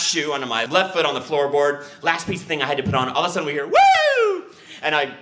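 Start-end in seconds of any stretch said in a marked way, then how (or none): 3.7-4.4 very high, loud "woo"; piercing mimicked siren blip; imitated siren whoop. Imitates police siren heard behind.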